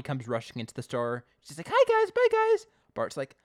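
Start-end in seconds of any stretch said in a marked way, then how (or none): 1.7-2.7 very high, loud, hammed-up hello and goodbye; acted girlish hurry; rushed greeting. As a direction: squeal it like someone dashing through. Acting Connie rushing in.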